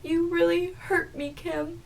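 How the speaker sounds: high, slow, soft whine; mock weepy hurt. Boyfriend to the woman leaving him, mock weepy hurt. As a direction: imitate a wounded boyfriend on the verge of tears.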